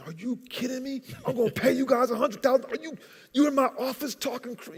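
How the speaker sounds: imitating the banker blowing up at the request; going ballistic. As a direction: rant at them like a furious banker. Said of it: "he's just going ballistic".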